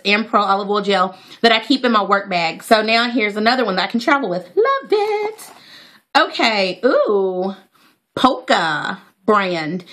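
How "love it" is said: high squealed love it; high happy squeal; delighted. Real, not acted. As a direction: coo with delight. Delighted with product.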